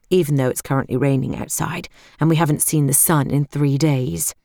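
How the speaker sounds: usual speaking voice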